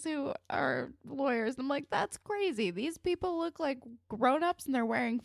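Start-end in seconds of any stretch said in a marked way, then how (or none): 1.9-5.2 higher, wide-eyed disbelief; amused incredulous voice, pitched up; mock amazement. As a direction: play it like a kid gawking at grown-ups. Mock amazement at lawyers' outfits.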